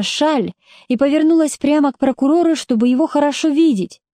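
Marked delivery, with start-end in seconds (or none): none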